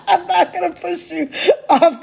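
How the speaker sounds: giggling while reassuring; laughing. Real, not acted. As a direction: promise it through real laughter.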